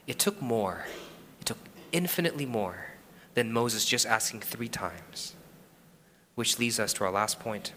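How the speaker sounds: soft, hushed preaching; softened sermon. Real, not acted. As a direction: drop the voice and let it sink in.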